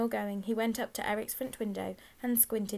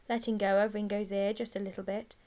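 ordinary delivery

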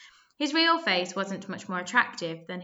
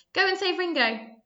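urgent plea; 10 semitones higher, 5 dB louder than usual. A narrator's reading. Small Zit urges the gang.

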